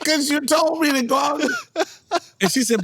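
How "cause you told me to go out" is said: put-on protesting kid. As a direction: plead your case like a wronged kid. Young self protesting to mother.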